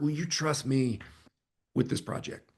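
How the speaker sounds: soft closing question leaning on "trust"; hushed ask with "trust" stressed. Shown as a demonstration. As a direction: drop the volume, put the weight on "trust". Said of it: "really stress that word".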